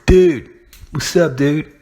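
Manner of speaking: mock surfer bro hello.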